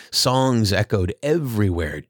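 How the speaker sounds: plain reading voice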